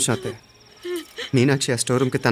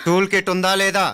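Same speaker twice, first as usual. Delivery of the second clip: slow, high, insistent question; raised voice, stretched-out interrogation; stern demand; 6 semitones higher than usual. Acted. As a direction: press hard for an answer. Interrogator repeats question, pressing suspect.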